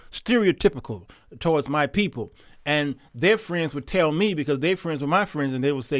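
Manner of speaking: ordinary delivery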